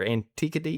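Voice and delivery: tentative, questioning "Antiquity"; unsure pronunciation. Real, not acted. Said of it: "help me out here".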